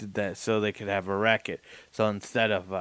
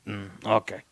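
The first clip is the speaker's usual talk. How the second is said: usual speaking voice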